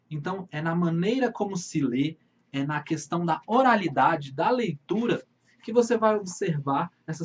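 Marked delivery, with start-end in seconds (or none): none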